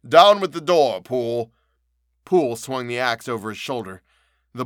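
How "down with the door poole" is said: much louder, higher order; loud cry. A narrator's reading. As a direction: shout the command to break in. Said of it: "cried Utterson".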